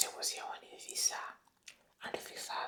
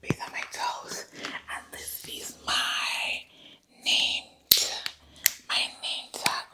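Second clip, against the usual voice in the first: Doctor Quackity Quack; 7 dB louder than usual. Acted. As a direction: play an overeager fake doctor, still whispering. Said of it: "I'm Doctor Quackity Quack".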